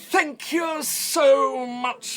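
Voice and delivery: high, loud, drawn-out fairground host; fairmaster accent. Acted. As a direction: welcome the crowd with grand, slow theatrical flourish. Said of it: "this is my accent that I'm gonna do".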